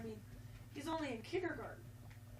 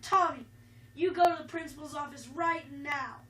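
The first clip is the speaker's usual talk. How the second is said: stern teacher; 9 dB louder than usual. Acted. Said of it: "stormed over to Tommy".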